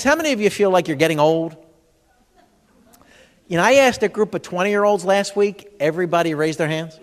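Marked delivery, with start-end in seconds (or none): none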